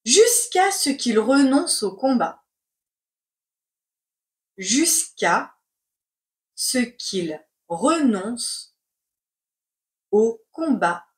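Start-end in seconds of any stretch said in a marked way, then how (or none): 4.6-11.1 slow, chunked with long pauses; slow dictation. Shown as a demonstration. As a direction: dictate word by word. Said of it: "C'est parti pour la dictée".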